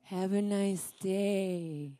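very slow, low, soft sign-off; drawn-out, over-controlled politeness; forced calm. Retold or imitated. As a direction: act out restraint with measured slowness. Forced calm reply to ranting husband.

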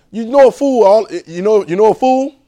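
high, loud question; preaching point; emphatic preaching. Acted. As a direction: press the crowd.

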